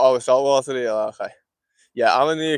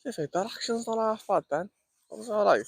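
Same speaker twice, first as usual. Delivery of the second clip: pleasantly surprised; 10 semitones higher, 4 dB quieter than usual. Real, not acted. Pleased by the lure's action.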